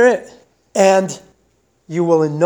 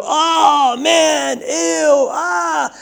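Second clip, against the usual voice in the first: disgusted yelps; 10 semitones higher, much slower than usual. Retold or imitated. Someone who just fell in the mud, high disgusted shouts. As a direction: yelp it out like you just landed in filth. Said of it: "you would go".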